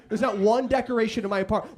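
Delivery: ordinary talk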